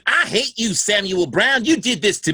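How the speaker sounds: high, loud mock scream of a woman in labor; hammed-up screaming wife; imitating wife screaming. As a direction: shriek it like a furious wife in labor.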